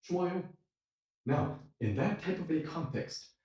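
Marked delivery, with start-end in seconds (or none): 0.0-0.4 high, delighted "Oh, cheesecake"; a happy yes to cheesecake; pleased reaction. Retold or imitated. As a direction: brighten up at the idea of dessert. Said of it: "And I'm gonna say".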